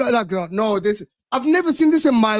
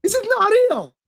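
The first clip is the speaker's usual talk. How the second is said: higher, stunned disbelief; 5 semitones higher than usual. Real, not acted. Chelsea fan seeing the transfer tweet, stunned disbelief. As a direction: react like you can't believe it.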